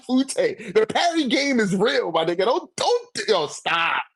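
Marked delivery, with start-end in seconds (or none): none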